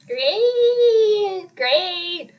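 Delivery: high, drawn-out singsong great; exaggerated sing-out cheer; mock-delighted great. Real, not acted. Mock celebration after the quick score.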